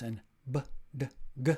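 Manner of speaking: b, d, g with the voice buzzing; teacher demonstrating b, d, g with the voice on; voiced sounds. Shown as a demonstration. Teacher to learners, demonstrating voiced b, d, g. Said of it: "Those are voiced".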